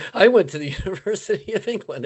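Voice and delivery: quick, light mock British accent. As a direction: imitate a silly British accent. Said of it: "and so he did a British accent".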